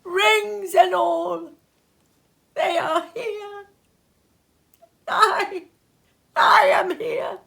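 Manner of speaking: high, slow, crying out with joy; tearful joy. A narrator's reading. Scrooge finding himself alive, crying out in joy. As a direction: sob out the relief, rising. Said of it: "laughing and crying".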